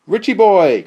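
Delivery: high, very loud, drawn-out hail; big exuberant shout-out to a friend; exclaimed greeting. A narrator's reading. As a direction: holler a delighted greeting to a buddy. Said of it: "Chris exclaimed".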